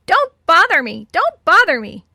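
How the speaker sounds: high, loud, harsh squawk; harsh parrot voice. A narrator's reading. Parrot mimics Betty's answer, harshly squawking. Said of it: "in a harsh voice".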